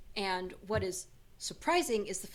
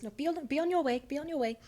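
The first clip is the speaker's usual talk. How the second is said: flustered shooing; 7 semitones higher, faster than usual. Acted. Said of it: "She blushes despite herself".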